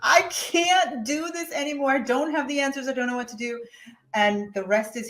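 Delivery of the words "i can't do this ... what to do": desperate plea. Retold or imitated. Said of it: "I go".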